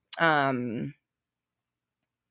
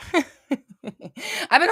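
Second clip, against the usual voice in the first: laughing; 7 semitones higher than usual. Real, not acted. Laughs after her own angry outburst.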